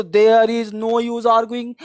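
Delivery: very slow model sentence; slow drill. Shown as a demonstration. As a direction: drag it out for students. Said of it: "teacher models phrase slowly". (धीमा अभ्यास वाक्य)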